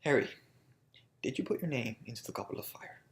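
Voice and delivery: very soft, hushed, whispered question; calm, restrained Dumbledore. Retold or imitated. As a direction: ask it gently, almost in a whisper. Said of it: "It's a very calm, very restrained moment".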